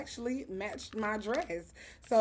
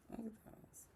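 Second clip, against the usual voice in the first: whispered; 18 dB quieter than usual. Real, not acted. Admiring lashes up close.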